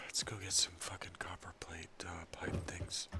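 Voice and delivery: low hushed whisper. Real, not acted. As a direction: whisper it like a secret. Whispered aside while playing.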